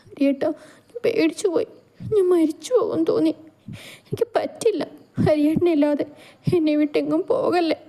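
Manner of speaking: put-on high, tearful pleading voice of a frightened girl; crying Gauri. A narrator's reading. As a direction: sob out the words, begging him not to leave. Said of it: "വീണ്ടും അവളുടെ കരച്ചിൽ ഉച്ചത്തിലായതും". (കരഞ്ഞുകൊണ്ട് യാചിക്കുന്ന ഗൗരി)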